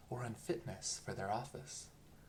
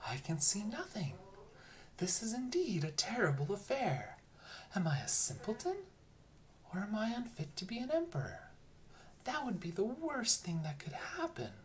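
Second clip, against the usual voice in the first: worried emperor; 8 semitones higher than usual. A narrator's reading. Emperor's anxious inner voice.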